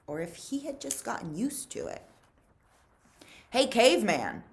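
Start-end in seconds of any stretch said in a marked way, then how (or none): 3.5-4.5 loud call across the tent; Twitch calling out. Acted. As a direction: call out to Stanley, louder. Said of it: "said Twitch".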